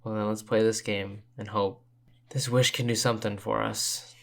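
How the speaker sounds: ordinary talk, nothing marked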